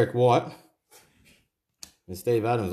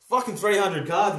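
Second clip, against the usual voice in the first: amazed; 8 semitones higher than usual. Real, not acted. Amazed at the pile of cards.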